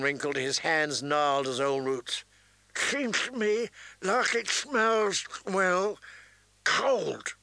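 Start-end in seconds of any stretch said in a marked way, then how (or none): 2.8-7.3 put-on slow, high old forester voice; toothless old man. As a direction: mumble it like a toothless old woodsman. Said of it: "He had taken out his teeth".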